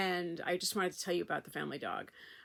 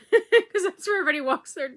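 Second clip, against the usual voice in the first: louder, higher words through real laughter; laughing; 9 semitones higher, 10 dB louder than usual. Real, not acted. Laughing at the nickname for the park's dog field, loud.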